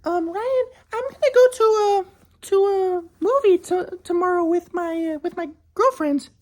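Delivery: high falsetto, slow and coy; mocking his ex. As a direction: drag it out like a sly girlfriend. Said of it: "and then she says".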